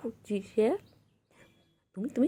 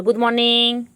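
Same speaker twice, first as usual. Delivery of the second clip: loud cheerful greeting; 2 semitones higher, 11 dB louder, slower than usual. Real, not acted. Prompting pet greeting.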